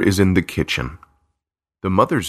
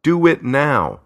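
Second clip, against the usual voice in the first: higher, curt order; commanding; 8 semitones higher than usual. A narrator's reading. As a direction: snap the order firmly. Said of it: "the voice orders".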